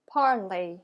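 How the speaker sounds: "parler" as "par-lay", final R silent; French pronunciation. Shown as a demonstration. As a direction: model "parler" with its ending rhyming with play. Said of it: "repeat after me".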